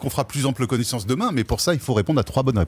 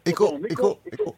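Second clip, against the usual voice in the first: fading echo repeats; 2 semitones higher, 4 dB quieter than usual. Pretend. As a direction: trail off like a mock echo. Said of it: "on s'entend en écho". (imitation d'écho qui s'éteint)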